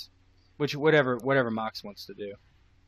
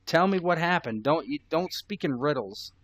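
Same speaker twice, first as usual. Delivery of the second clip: demanding; 5 semitones higher, 4 dB louder than usual. Acted. Malik demands an answer.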